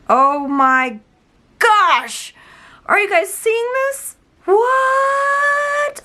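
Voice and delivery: high-pitched, rising amazed outcry; can't believe your eyes, draw out the last word; stunned squeal of disbelief; astonished. Real, not acted. Astonished by powder changing color.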